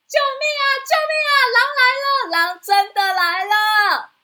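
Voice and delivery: very high, loud desperate screaming for help; terrified scream. Acted. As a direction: scream in terror as the wolf attacks. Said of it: "朝着山下大喊". (惊恐呼救)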